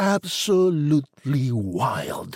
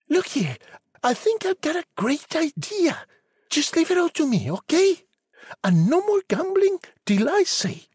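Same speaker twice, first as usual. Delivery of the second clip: put-on high, brisk girl's voice; a bossy bright young girl. A narrator's reading.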